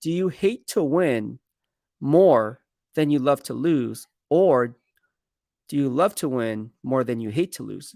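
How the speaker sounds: slow, spaced-out question with pauses. Retold or imitated. Scout repeats his question for a confused player, slowly. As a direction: lay the question out piece by piece. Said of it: "Okay, let me say it slower".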